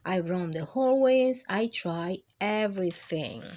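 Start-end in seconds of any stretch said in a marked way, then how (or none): none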